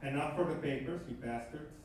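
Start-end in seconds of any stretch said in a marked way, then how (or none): none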